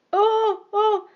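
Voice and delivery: high, loud delighted gasp; surprised cry. A narrator's reading. The old lady cries out at the apology, high and surprised. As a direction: squeal with surprise.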